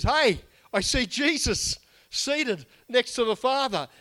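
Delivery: slow, high and awed; awed martyr voice. Retold or imitated. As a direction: speak like a man seeing heaven. Stephen's vision, quoted in sermon.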